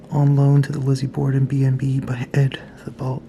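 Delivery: hushed reading whisper; nervous night vlog whisper. Real, not acted. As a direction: read the label in a whisper. Whispering exhibit label.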